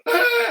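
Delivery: very high-pitched mock wail; imitated child's cry. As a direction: mimic a terrified little kid bursting into tears. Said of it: "I started crying".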